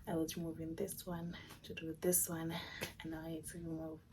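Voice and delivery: ordinary delivery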